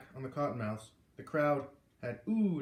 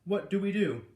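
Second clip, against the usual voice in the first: louder, higher impatient demand; 6 semitones higher, 7 dB louder than usual. A narrator's reading. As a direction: demand an answer now. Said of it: "Mister Muckle demanded".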